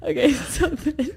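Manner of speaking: cracking up mid-sentence; laughing. Real, not acted. Wife laughs at how ridiculous the story gets, giggly. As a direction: try to talk through the giggles.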